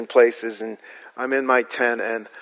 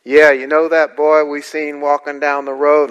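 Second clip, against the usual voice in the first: rural local; 3 semitones higher, 5 dB louder than usual. Retold or imitated. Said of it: "So they call and go".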